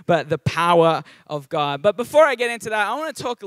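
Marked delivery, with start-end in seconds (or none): 0.1-1.8 "the power of God" with a non-rhotic Australian "power"; Australian accent. Shown as a demonstration. Pastor contrasting with his Australian way of saying it. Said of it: "getting my Australian accent back".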